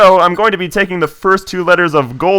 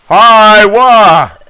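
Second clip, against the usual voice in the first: startled cry; 6 semitones higher, 7 dB louder than usual. Acted. Finding someone collapsed on sand.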